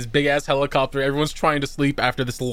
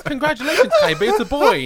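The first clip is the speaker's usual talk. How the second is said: high, bright robotic congrats; mock computer announcement; 11 semitones higher, 3 dB louder, faster than usual. Kaiba's computer announcing, high chirpy voice. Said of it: "computer just goes".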